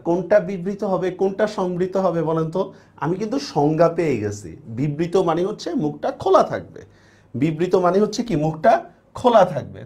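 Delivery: ordinary delivery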